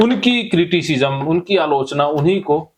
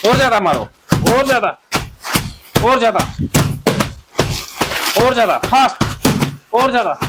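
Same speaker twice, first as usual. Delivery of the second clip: high, punchy bursts with gaps; urgent cathartic coaching; 8 semitones higher, faster than usual. Acted. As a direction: drive them harder with each command. Drives the group harder.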